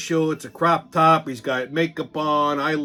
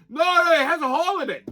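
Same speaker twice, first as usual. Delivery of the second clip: arguing customer; 10 semitones higher than usual. Retold or imitated. Acted customer arguing over a bagel.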